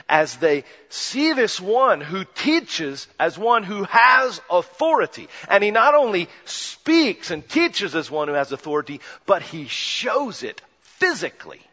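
emphatic pulpit delivery; full-force preaching. Acted. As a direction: preach it with mounting fervor.

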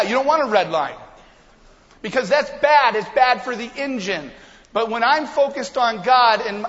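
loud, high, driving sermon voice; full-force preaching. Acted. Pastor urging the congregation, loud and driving. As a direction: preach it hard and build.

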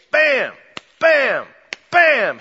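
high, loud, punched bams; dramatic staccato exclamations; emphatic bams. Acted. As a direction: slam each bam like a hammer blow. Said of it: "Mark tries very emphatically to say".

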